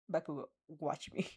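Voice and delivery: ordinary delivery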